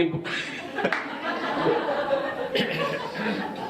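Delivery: soft airless laugh; high soft laugh; breathy laugh. Retold or imitated. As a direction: laugh under the breath at him. Wife laughs at his suspicion.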